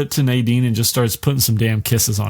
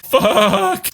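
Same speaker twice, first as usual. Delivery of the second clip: very high, louder mock cry; hammed-up despairing yelp; 3 dB louder than usual. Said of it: "just like".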